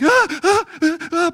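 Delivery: high, loud panicky gasps; hammed-up fearful gasping; panicked gasps. One frightened man sees the chariots, high panicked gasps. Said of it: "panicked and fearful".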